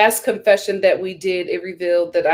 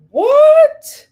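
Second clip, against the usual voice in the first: loud, very high squeal of disbelief; piercingly high, incredulous reaction; loud mock shock; 9 dB louder than usual. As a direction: shriek it like outrageous gossip. Reacting to the quote just read.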